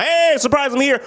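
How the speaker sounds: spring out of the closet, playfully high; goofy high surprise greeting; mock surprise pop-out. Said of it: "And he pops out".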